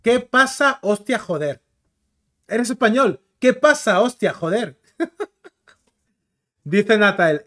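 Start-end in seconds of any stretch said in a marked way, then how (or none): 0.0-4.8 put-on a light jokey take on a central Spain accent, a bit higher and louder; mock Spaniard. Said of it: "siempre me hacen la broma esta".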